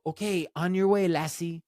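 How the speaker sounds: a hint of a UK coach sending someone off; UK football coach. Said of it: "football coach in the U K".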